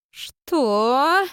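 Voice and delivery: loud, high, threatening what; menacing. Acted. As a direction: demand it with menace. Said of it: "грозно повторила Камила".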